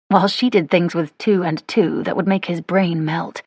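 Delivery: ordinary delivery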